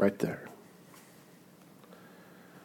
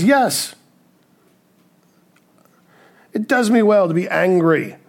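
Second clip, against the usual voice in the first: defiant Jonah; 7 semitones higher, 5 dB louder than usual. Retold or imitated. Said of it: "He says".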